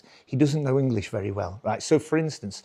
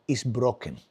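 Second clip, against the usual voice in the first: put-on lower, Spanish-accented announcement; 3 semitones lower than usual. Spanish father reporting broken laptop to son, accented. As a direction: deliver the verdict in a Spanish accent. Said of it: "He goes, uh".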